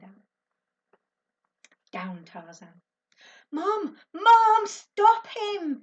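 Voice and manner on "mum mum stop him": high, loud frantic call for help; a boy's panicked cry for mum. A narrator's reading. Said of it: "Ben called out".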